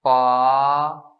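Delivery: second tone on पा; rising tone. Shown as a demonstration. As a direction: let पा climb at the end. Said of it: "उठा के पढ़ना है".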